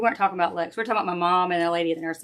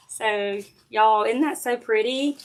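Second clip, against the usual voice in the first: normal talking voice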